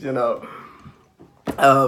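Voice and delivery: ordinary talk, nothing marked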